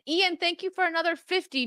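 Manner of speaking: high teasing put-on voice; mocking voice. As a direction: needle her like a smug friend. S1 voicing someone mocking her.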